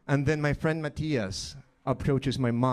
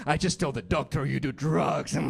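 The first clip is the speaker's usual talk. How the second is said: gloating sister with a chuckle; put-on tattletale glee, giggle after; rejoicing sister; 2 semitones higher than usual. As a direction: crow over getting your brother in trouble. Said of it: "because she's rejoicing in herself".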